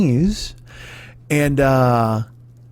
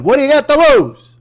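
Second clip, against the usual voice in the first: high, louder pitchman tagline; mock pitchman; 9 semitones higher, 5 dB louder than usual. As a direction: sell it like a cheesy ad.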